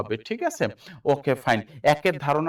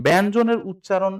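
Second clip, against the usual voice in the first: ব্যঞ্জনের উচ্চারণ called out louder and higher; announced heading; 5 semitones higher, 6 dB louder than usual. Shown as a demonstration. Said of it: "একটু খেয়াল করেন". (ব্যঞ্জনের উচ্চারণ জোরে ঘোষণা করে)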